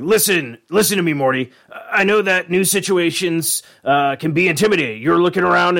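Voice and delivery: put-on a firm speech to rally a scared kid; Rick's pep talk. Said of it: "he says it like this".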